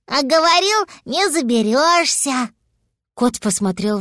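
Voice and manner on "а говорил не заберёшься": little dragon. A narrator's reading. Little dragon to Papa up the tree, high-voiced teasing. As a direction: tease Papa from below. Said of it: "раздался снизу голос гусли".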